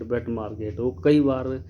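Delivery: ordinary delivery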